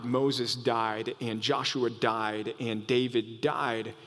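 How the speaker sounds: slow, soft, low; hushed, slow enumeration; solemn slow list. Real, not acted. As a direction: let each death land quietly.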